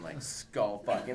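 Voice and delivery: ordinary talk, nothing marked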